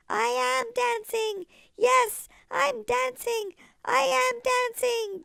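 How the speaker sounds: high, loud, overjoyed shouting; joyful cry. Acted. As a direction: burst out with pure joy. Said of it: "Gerald cried".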